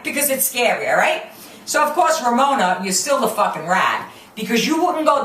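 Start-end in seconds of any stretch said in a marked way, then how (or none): none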